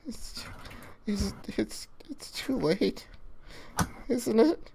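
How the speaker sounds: almost no voice left, giving up; despairing murmur. Acted. Lucha asks Hunter if it's too late, barely audible.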